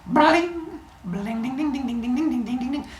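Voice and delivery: high ringing string plinks; rapid sing-song twanging; imitated lyre strumming. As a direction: mimic a little harp being strummed.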